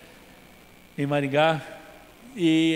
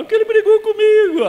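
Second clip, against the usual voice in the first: high, louder falsetto imitating the crying wife; husband mocking his wife's tearful complaint, much higher and louder; whiny wife voice; 10 dB louder than usual. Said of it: "Ela fala".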